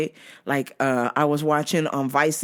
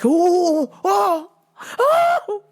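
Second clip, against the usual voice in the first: high wailed ohs, climbing; mock anguish; 11 semitones higher, 3 dB louder, much slower than usual. Worker suffering the stalker's greeting, mock-anguished wails. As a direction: wail each oh higher, half laughing. Acted pained reaction.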